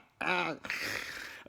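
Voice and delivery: high chuckle trailing on; helpless giggle after a joke; laughing. Real, not acted. Cracks up at own innuendo.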